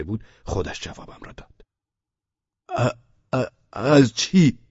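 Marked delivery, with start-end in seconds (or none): 2.7-4.6 halting stammer on the first sound; stutter, then push the question out; stuttering boy. A narrator's reading. (پسری با لکنت زبان)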